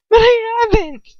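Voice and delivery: high, loud tearful denial; desperate cry. A narrator's reading. Captive denying the accusation, tearful and desperate. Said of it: "I whisper desperately".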